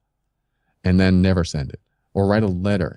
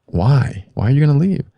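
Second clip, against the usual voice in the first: higher, louder panicked why; alarmed plea; 6 semitones higher, 5 dB louder than usual. Retold or imitated. Boyfriend begging the girlfriend who says she will leave, louder and higher. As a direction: beg her to explain, rising in alarm. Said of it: "I'm like".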